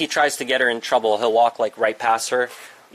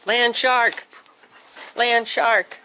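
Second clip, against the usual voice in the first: high, loud playful cry of land shark; playful shout; 11 semitones higher, 4 dB louder, much slower than usual. Pretend. Owner teasing the biting dog, high playful shout. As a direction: yell it like a monster alarm.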